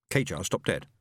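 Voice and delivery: usual speaking voice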